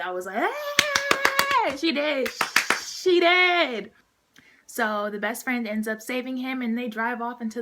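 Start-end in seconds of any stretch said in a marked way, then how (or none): 0.4-1.8 long, very high, loud squeal; gleeful scream. Retold or imitated. Acting out own cheering reaction. 1.8-3.9 high, loud celebratory yell; gleeful shouting. Retold or imitated. Celebrating the girlfriend's death.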